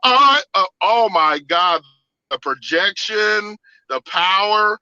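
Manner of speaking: high, loud, slow awed outcry; loud slow gasp of wonder; amazed. Retold or imitated. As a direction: marvel at the power, loud. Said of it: "it was like".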